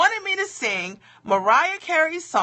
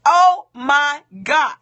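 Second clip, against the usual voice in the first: loud, slow Oh my God; a loud, slow wail of dread; loud dismayed exclamation; 4 semitones higher, 9 dB louder, slower than usual. Real, not acted. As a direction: drag out the horror, loud. About to sing in public.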